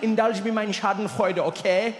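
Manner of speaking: put-on German-accented placating; amused, coaxing German accent; German friend's accent. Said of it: "He's like".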